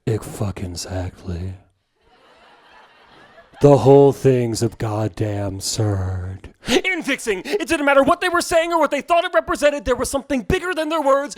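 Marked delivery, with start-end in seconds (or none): 0.1-6.5 very low, drawn-out world-weary voice; deep, slow smoker's ennui; stylish ennui. Acted. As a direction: sigh it out, bored and too cool. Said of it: "a puff of stylish smoke and ennui".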